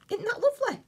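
very high, loud squeal of delight; thrilled squeal over a tiny gift. Real, not acted. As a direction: gush with real excitement over it. Thrilled by adorable gift item.

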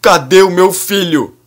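high, loud anguished shout; desperate father shouting. A narrator's reading. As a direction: yell out for the son. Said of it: "Ari grita ainda mais alto".

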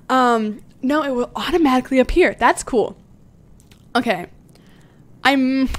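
usual speaking voice

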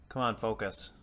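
normal talking voice

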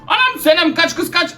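tiz, panik içinde bağırarak kaç; kız kardeşine kaçmasını haykırır gibi; kovalanırken panik. Real, not acted. Grim Shake saldırıyor, kaçıyor. (kovalanan birinin telaşlı çığlığı)

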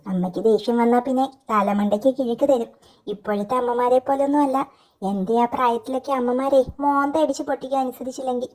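usual speaking voice

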